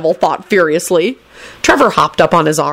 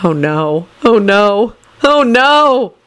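dismay climbing higher with each repeat; mounting dismay; 5 semitones higher, much slower than usual. A narrator's reading. Neville sees his mother's hair let down, mounting dismay. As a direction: start plain, build to a high wail.

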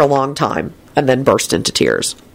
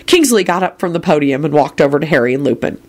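plain reading voice

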